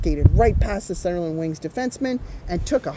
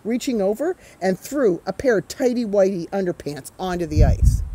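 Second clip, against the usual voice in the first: usual speaking voice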